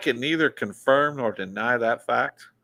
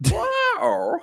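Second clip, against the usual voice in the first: high shriek. Retold or imitated. Witch-like shriek after dog bit.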